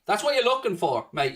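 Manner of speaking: usual speaking voice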